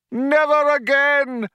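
high, drawn-out rallying cry. A narrator's reading. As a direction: ring it out over the crowd. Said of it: "cried the man".

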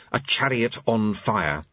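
usual speaking voice

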